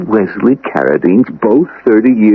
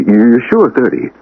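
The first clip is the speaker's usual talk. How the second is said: ordinary delivery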